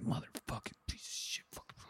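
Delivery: exasperated whispered curse. Retold or imitated. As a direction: hiss it under your breath like grumpy Santa. Santa cursing at Amazon boxes.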